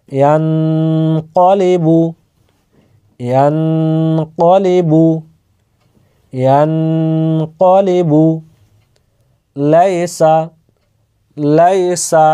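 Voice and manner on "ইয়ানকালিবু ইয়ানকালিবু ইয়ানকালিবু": ইয়ানকালিবু drawn out syllable by syllable, the vowels held; slow careful recitation. Shown as a demonstration. Teacher repeating the word for learners, slow and careful. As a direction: recite ইয়ানকালিবু slowly and evenly three times. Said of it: "উচ্চারণগুলো দেখেন ভালোভাবে".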